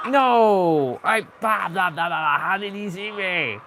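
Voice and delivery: high, loud frantic gamer panic; panicking gamer. Real, not acted. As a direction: freak out as the killer catches you. Caught by the killer mid-game.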